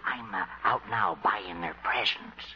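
low, hushed confidential aside; whispering. Acted. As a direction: keep it secret from someone nearby. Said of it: "What are you whispering about".

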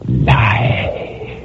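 unvoiced eerie whisper; a ghostly whisper from below. A narrator's reading. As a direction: breathe it from the abyss. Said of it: "one short whispered word".

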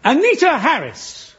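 slow, high showbiz introduction; big build-up. Acted. Host introduces the award guest to the audience, big build-up. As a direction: announce the star with drawn-out fanfare. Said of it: "a big build-up".

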